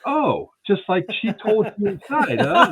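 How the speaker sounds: needling the deputy with a slow, loud, high sarcastic huh; sarcastic gotcha. Retold or imitated. Catching the deputy out, retold.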